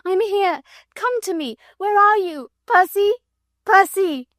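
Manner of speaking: high, loud frantic cries; shrieking calls into the dark; hysterical calling. A narrator's reading. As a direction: call out to him, desperate. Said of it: "she shrieked hysterically".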